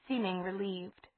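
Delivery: ordinary delivery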